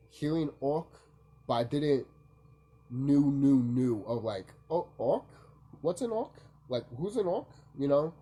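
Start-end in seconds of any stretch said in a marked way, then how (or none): 4.7-7.5 higher, questioning re-enacted confusion; retold puzzlement. Hearing of orcs for the first time, re-enacted puzzlement. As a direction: replay your baffled reaction, pitch climbing. Retelling own confusion at new word.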